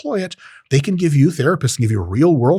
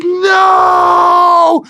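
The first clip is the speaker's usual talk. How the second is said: long, high anguished shout; hammed-up scream of despair; melodramatic wail; 7 dB louder than usual. Hunter whose deer ran away.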